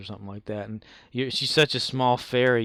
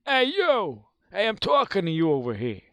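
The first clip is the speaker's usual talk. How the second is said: loud, high Bronx tough-guy fairy; Navi from the Bronx; 8 semitones higher, 4 dB louder than usual. Acted. Streamer plays Navi from the Bronx, loud high Bronx heckling. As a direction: bark it like a Bronx street fairy. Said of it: "Navi from the Bronx".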